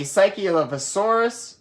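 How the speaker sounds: plain reading voice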